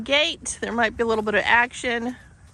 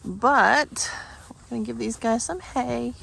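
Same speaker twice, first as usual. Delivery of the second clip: whispering; 4 semitones higher than usual. Real, not acted. Whispered aside while walking.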